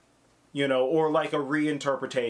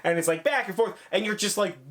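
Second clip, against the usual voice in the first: normal talking voice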